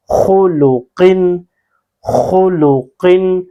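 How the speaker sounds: slow demo of خلقن with a thick, heavy خ, the mouth full; heavy full-mouth letter. Shown as a demonstration. Quran teacher showing students the heavy خ, slow and full-mouthed. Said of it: "منہ بھر کے آئے".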